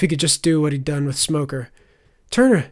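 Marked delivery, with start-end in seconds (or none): 2.3-2.7 high, loud startled call. A narrator's reading. Blindfolded Faye calls to Turner, startled call. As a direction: call his name in confusion.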